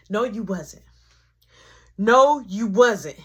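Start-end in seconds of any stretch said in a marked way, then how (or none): 2.0-3.2 louder, slow insistent denial; slow, louder flat denial. Retold or imitated. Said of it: "now y'all think I'm crazy".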